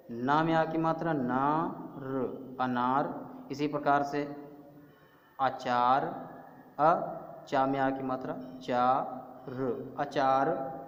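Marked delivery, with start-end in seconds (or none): 1.1-2.6 नार with the आ held very long; stretched long vowel. Shown as a demonstration. Teacher sounding out नार slowly for children. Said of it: "यानी देर तक उसको बोलेंगे". 8.6-9.9 चार with its आ drawn out slowly; stretched long vowel. Shown as a demonstration. Teacher to young pupils, stretching out चार. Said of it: "यानी देर तक उसको बोलेंगे".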